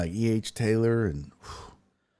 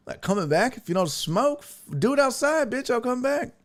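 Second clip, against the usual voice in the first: high, cocky refusal; jokingly turning down the podcast hosts; mock defiance; 12 semitones higher, faster than usual. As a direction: brush them off with a laugh. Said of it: "And I was like".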